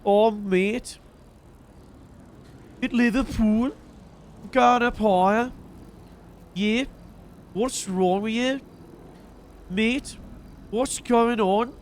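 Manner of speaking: put-on Liverpool accent. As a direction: have a shaky go at a Liverpool accent. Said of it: "Maybe I'll do the fucking Liverpool one".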